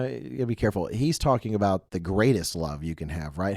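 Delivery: ordinary talk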